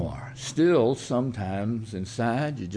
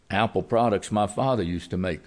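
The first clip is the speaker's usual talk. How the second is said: usual speaking voice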